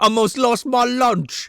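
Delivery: high, slow Australian impression; mock Australian accent. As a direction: stretch it out in a put-on Australian accent. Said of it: "losing my Australian accent".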